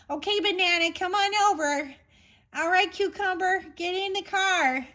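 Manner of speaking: high, singsong mock parent calling a kid. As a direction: call the kids over in a silly high voice. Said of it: "I wanna make fun of it".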